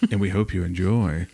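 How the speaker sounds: deliberately eerie, breaking into a laugh; ghost story voice. Shown as a demonstration. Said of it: "I'm gonna keep saying it like a ghost story".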